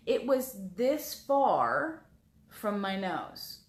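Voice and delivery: usual speaking voice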